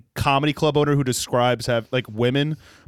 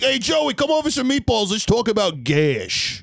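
high, slow New York tough guy; Joey Diaz voice; 9 semitones higher, slower than usual. Retold or imitated. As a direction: belt it like a greasy New Yorker. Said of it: "like Joey Diaz if he never did comedy".

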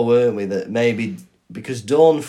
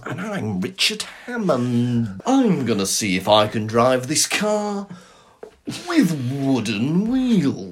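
slow, drawn-out presenter delivery; mock car-show presenter. Introducing a stunt to viewers, hammy presenter drawl. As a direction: stretch it out like a parody motoring host.

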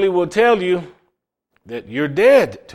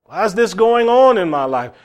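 raised, anguished plea to the Lord, higher and louder; crying out to God; 7 semitones higher, 6 dB louder than usual. Retold or imitated. Retelling a student crying out to God, loud plea. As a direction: call out to God in distress. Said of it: "I cried out to God and said".

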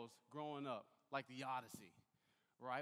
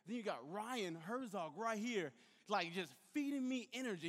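ordinary delivery

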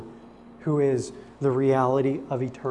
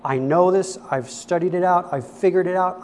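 louder, puffed-up bragging, lightly voiced; boastful rich young ruler; 3 semitones higher, 6 dB louder than usual. The rich young ruler bragging to Jesus about keeping the law, loud and proud. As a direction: brag loudly that you've mastered it all. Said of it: "That's basically what he said".

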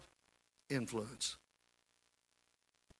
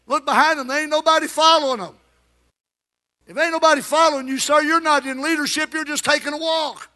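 raised, higher preaching voice, near shouting; full-force preaching; 8 semitones higher, 4 dB louder than usual. Acted. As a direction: preach it hard to the congregation.

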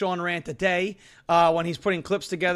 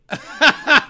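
big burst of laughter; real hearty laugh; loud laugh; 6 semitones higher, 7 dB louder than usual. Real, not acted. Laughing at the comment.

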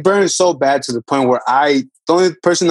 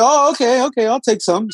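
high-pitched, eager acceptance; light, agreeable retold reply; easy agreement; 8 semitones higher than usual. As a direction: replay saying yes, pitched well up. Acting his own agreeable reply.

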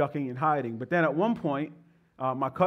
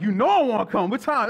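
eager new believer; 6 semitones higher, 4 dB louder than usual. Retold or imitated. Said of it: "And I was like".

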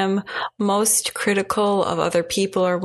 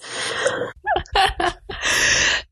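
breathy laugh; 12 semitones higher than usual. Real, not acted. Laughs at her own tweeting.

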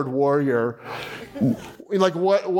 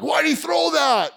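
indignant, much higher and slower jeer at the TV; armchair quarterback; 12 semitones higher, slower than usual. Retold or imitated. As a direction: play a fan yelling at the screen from the couch. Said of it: "it's like the armchair quarterback".

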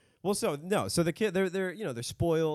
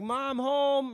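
high, louder call of Mom, home; 13 semitones higher, 3 dB louder than usual. Retold or imitated. Cousin arriving home calls out to his mom, high and loud. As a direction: announce you're home to the house. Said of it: "then he's like".

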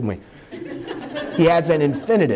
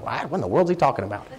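a lightly voiced baffled teen; quick, puzzled mock teenager; teen lost in church jargon, lightly mocked; confused teen; 3 semitones lower, 5 dB quieter, faster than usual. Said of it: "the teens are going".

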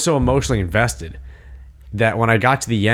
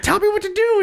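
a much higher, squeaky begging whine; desperate plea; faster than usual. Retold or imitated. Begging roommate Chet to make the choice, squeaky mock desperation. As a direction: beg like you can't bear to decide.

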